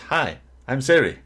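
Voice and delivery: high, loud, slow fake Irish Siri; terrible Irish accent. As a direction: put on a bad Irish assistant accent. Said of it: "That was a terrible accent".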